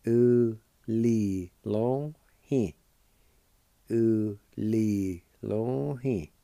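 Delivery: "U li long he" drawn out very slowly; slow Cherokee pronunciation. Shown as a demonstration. Teacher modelling the Cherokee word for ideal slowly. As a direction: stretch each syllable of "U li long he". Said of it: "It's pronounced like this".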